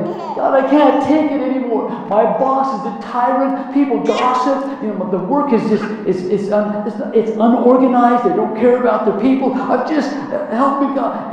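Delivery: loud, high, exasperated complaint to God; desperate venting prayer. Shown as a demonstration. Praying about a tyrant boss, loud and exasperated. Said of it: "so you're praying".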